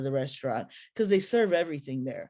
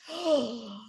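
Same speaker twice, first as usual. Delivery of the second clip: gasp; 4 semitones higher than usual. Retold or imitated. Realisation moment.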